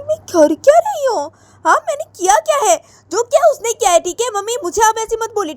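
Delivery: high, loud, indignant protest; angry daughter. Acted. Radhika snapping at her mother, high angry protest.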